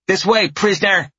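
put-on high loud child command; barking little girl. A narrator's reading. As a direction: bark orders like a tiny guard. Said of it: "the tiny one barks".

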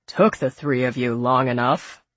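put-on low, gruff older man. A narrator's reading. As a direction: grumble it low and rough. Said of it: "a gruff voice interrupted".

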